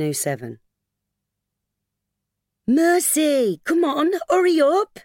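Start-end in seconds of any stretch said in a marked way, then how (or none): 2.7-3.6 high, loud call of "Mercy". Acted. Calling the child. 3.6-5.0 put-on high, loud calling to a child.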